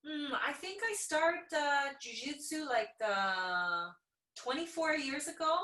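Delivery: offhand, pondering the years; a hint of a relaxed legend. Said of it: "she's like".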